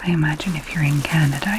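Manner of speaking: usual speaking voice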